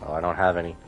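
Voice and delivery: ordinary talk, nothing marked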